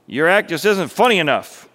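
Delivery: a touch of a harsh director dismissing a performer; mild acted shout. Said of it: "the perfectionist shouted".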